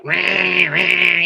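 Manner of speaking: high, buzzing mock roar; imitated tattooing noise. Imitates Spider tattooing wildly.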